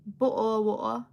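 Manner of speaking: mock British accent with glottal stops; teasing British accent; put-on British accent. Shown as a demonstration. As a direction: say it British, swallowing the t's. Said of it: "I know you don't say that".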